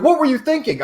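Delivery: imitating mom's high-pitched reproach; mock panicked scolding. Acting out panicking mother's scolding.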